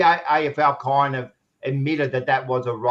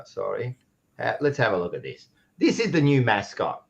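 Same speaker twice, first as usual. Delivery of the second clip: usual speaking voice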